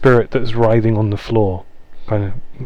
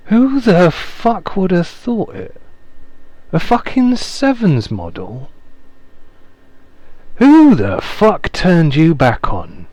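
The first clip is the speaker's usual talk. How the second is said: Pax's voice; 9 semitones higher than usual. Acted. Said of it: "and goes".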